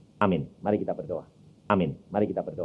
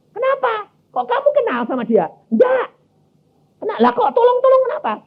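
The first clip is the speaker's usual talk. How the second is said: incredulous; 5 dB louder than usual. Retold or imitated. Couple arguing, husband questions wife.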